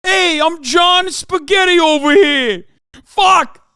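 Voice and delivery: slow, loud, high cartoon Italian; mock Italian accent. Streamer inventing John Spaghetti: slow, loud mock Italian accent. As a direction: ham up a cartoon mobster Italian.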